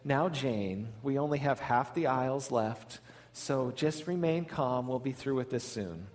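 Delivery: calm, low-key reassurance; very calm mom. Retold or imitated. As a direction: soothe quietly, keeping your composure. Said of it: "the mom just very calmly said".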